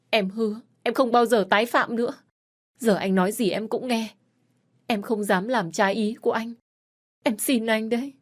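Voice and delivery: high, loud tearful pleading; wife begging husband not to divorce her; desperate begging. Acted. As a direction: throw yourself at his feet with your voice.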